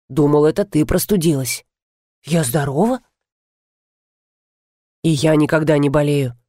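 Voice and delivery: lightly voiced low baritone; baritone man. A narrator's reading. Man arguing in bed about who caught cold, low baritone. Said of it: "возразил баритон".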